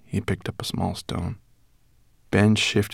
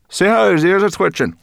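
high, loud chirpy brownie; high brownie voice, talking with her mouth full; 13 semitones higher, 10 dB louder than usual. Acted. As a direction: pitch her up into a bright little creature.

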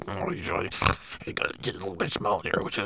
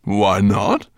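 dragon voice; 4 semitones higher, 10 dB louder, slower than usual. Acted. Dragon mocking dwarf.

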